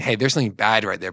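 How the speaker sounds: ordinary talk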